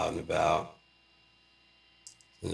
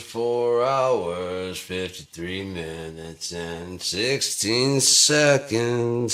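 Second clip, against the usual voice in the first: higher, louder, slow grand proclamation; hammed-up announcer; 7 semitones higher, 6 dB louder, much slower than usual. Pompous countdown announcement to viewers. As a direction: declaim it like a stage herald.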